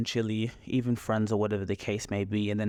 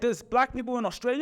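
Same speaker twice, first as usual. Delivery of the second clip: much higher, mock astonished; amazed disbelief; 11 semitones higher than usual. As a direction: play the stunned stranger hearing the news. Mimicking foreigners' amazed reaction.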